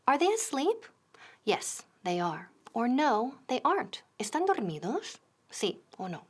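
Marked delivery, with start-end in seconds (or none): none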